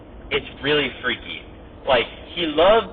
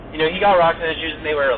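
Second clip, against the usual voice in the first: ordinary delivery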